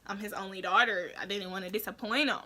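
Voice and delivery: usual speaking voice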